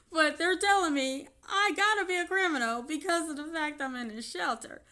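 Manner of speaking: ordinary talk, nothing marked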